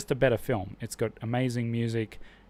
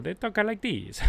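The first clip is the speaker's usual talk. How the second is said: a lightly hammed-up Italian accent, much higher; mock Italian; 9 semitones higher than usual. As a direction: tease the Italian way of talking. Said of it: "like a lot of Italian people".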